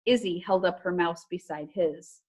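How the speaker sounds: plain reading voice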